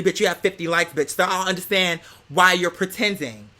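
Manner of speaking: ordinary talk, nothing marked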